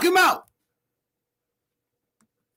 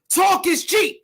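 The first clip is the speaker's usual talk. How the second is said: ordinary delivery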